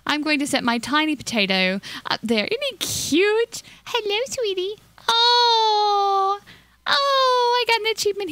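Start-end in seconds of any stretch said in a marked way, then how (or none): 2.5-8.3 high squeaky coo at a cute pet. Real, not acted. Gamer cooing at her tiny potato, high and squeaky. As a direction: gush over the little potato in a high voice.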